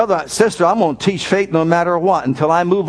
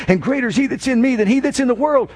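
ordinary delivery

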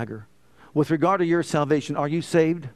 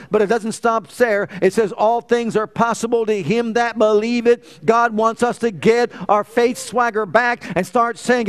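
higher and louder, driving exhortation; full-force preaching; 5 semitones higher, 4 dB louder than usual. Acted. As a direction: rouse the congregation toward Hallelujah.

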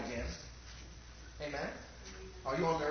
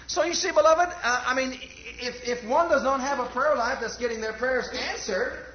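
loud, slowed, pleading preacher; full-force pulpit appeal; fervent preaching; 4 semitones higher, 7 dB louder than usual. Acted. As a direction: press the congregation hard.

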